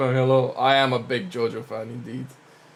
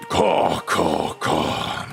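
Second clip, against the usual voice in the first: low crow caws; 8 semitones lower, much slower than usual. Acted. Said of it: "let me get that voice".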